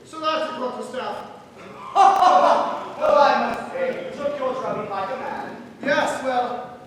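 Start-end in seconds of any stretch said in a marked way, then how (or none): 1.2-2.9 loud breathy laugh; hearty laugh. Acted. Loser laughing off his drubbing. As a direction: laugh off the defeat. Laughs after losing the fight.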